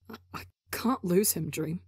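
choked, thick with tears; tearful whisper. A narrator's reading. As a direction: whisper it, close to crying. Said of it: "Tubbo whispers thickly".

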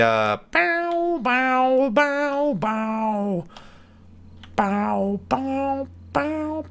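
high, far-off sing-song bow bow; mock bow bow noise. Gamer imitating a sound effect, high sing-song. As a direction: chant bow like a cartoon noise.

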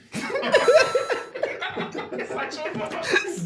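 high-pitched burst of laughter; long, real giggling fit; laughing. Real, not acted. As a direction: crack up at a terrible pun. Cracks up at a bad pun.

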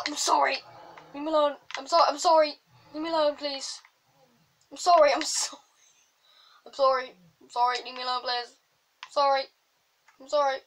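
panicked gamer yelping apologies; frantic pleading. Real, not acted. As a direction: beg an attacker to back off. Begging attacking bear to stop.

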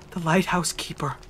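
breathy hushed secret; whisper. Acted. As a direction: lean in and whisper it. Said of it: "His voice falls to a whisper".

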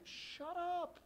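high sharp rebuke; snapping shut up. Acted. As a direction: cut the man off. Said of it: "the disciples say".

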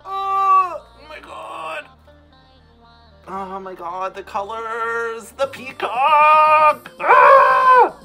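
high, loud delighted scream; excited screaming. Real, not acted. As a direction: scream like a thrilled fan. Screaming at the peacock look.

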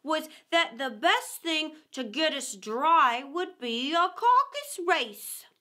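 slow, emphatic, huffy proposal; offended. A narrator's reading. Dodo insisting on its idea after mockery, huffy. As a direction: build slowly to a grand final phrase. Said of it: "in an offended tone".

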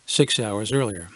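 ordinary delivery

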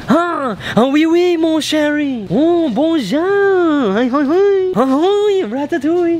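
high, drawn-out mock French; French accent. Showing a stranger an accent to guess, exaggerated French accent. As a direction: ham up French stock words, lilting high. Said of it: "I'm speaking in accent".

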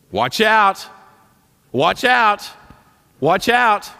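high, loud repeated "watch out"; loud warning. Retold or imitated. Preacher voicing Paul warning the church, loud and high. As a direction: call out the danger again and again. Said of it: "Well, Paul says".

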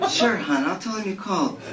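high, flirty woman's voice with a laugh; sultry woman. Acted. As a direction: purr it like a seductive woman. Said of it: "voice of a woman".

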